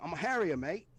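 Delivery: a hint of a British accent, casual and cocky. British pilot introducing himself on the radio, light British accent. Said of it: "He's got a British accent".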